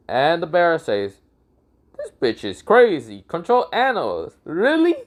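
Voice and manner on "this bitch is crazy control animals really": mock disbelief climbing to a squeak; incredulous scoff. Bear scoffs at the man's claim about animals, incredulous. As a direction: pitch shooting up on the final question.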